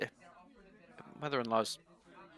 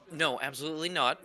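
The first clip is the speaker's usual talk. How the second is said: ordinary talk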